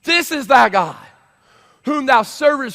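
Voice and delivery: high, forceful declaration; loud proclaiming king. Acted. Preacher acting out the yelling king.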